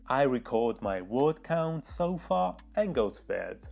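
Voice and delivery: ordinary talk, nothing marked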